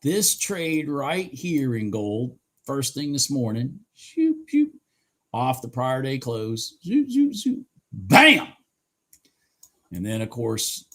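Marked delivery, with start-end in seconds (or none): 4.0-4.7 high, quick mock whoosh sounds; shooting sound effect. Imitating price shooting up. 6.9-7.6 high mock zoom noises; zooming sound effect. Imitating price shooting up. 7.9-8.5 bam. Real, not acted. Punctuating the price hit.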